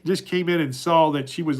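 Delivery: normal talking voice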